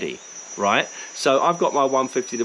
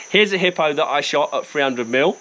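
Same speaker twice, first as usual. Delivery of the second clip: normal talking voice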